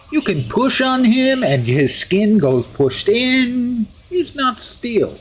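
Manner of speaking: hammed-up fake Russian accent, pitch often leaping up; musing steel hero with a mock Russian accent; deliberately bad Russian accent. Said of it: "bad Russian accent".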